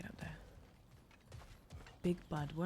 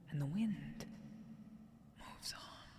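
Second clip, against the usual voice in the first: softer, fading whisper to the end; whispered recitation; 3 semitones lower, 11 dB quieter, slower than usual. Acted. Player finishing the poem, fading whisper. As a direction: let the line drift away in a breath. Reading the found poem aloud.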